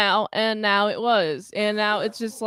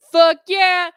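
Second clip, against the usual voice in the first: a higher, louder whoop of approval; mock cheer; 7 semitones higher, 7 dB louder than usual. Retelling his reaction to the movie, a higher, louder mock cheer. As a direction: cheer the kill like a fan.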